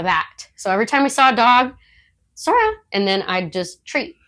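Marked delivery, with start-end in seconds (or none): 2.3-2.7 calling Sora, pitch jumps much higher; calling dog. Retold or imitated. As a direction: call the dog to look at you, high and bright. Calling dog's name.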